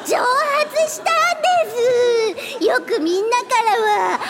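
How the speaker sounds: put-on wailing ghost girl. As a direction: cry out as the pitiful ghost girl.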